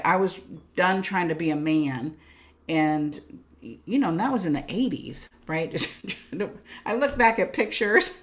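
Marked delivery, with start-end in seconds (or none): 5.8-6.8 airy laugh under the breath; soft breathy laugh. Real, not acted. Laughing at her eighties photos, soft breathy laugh. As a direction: laugh quietly at the memory.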